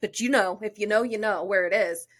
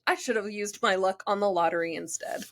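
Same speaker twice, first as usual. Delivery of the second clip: usual speaking voice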